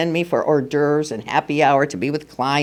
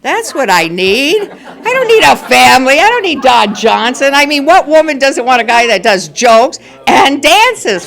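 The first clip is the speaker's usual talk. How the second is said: giddy excitement; 8 semitones higher, 6 dB louder than usual. Retold or imitated. Mock swooning over Al.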